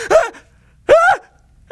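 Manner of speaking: high, loud yelps building; pained screams. Retold or imitated. Cuff squeezing a burnt arm, loud high pain screams. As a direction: mock-scream in bursts of pain.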